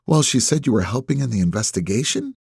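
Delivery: "investigation?" lifted at the end like a question; statement as question. A narrator's reading. Woman fishing for confirmation, "investigation" turned up as a question. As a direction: let "helping in the investigation" rise into a question. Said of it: "says it like a question".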